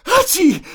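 big, high-pitched mock sneeze; loud stagey achoo mid-sentence; acted sneeze. (lautes, hohes gespieltes Niesen)

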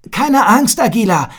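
high, loud reassuring call. A narrator's reading. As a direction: shout courage to his friend. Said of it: "rief er".

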